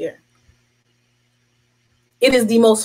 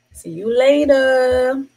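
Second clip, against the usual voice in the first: drawn-out slow mocking goodbye; slow dismissive so-long; sassy farewell; 3 semitones higher, much slower than usual. Real, not acted. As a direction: wave them off with sass. Dismissing the bums.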